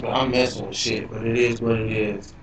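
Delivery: normal talking voice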